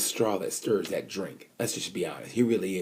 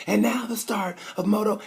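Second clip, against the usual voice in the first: announcer voice; 13 semitones higher than usual. Retold or imitated. Imitating Nick Harris announcing the race start, high. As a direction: mimic the TV commentator starting the race. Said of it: "When Nick Harris is like".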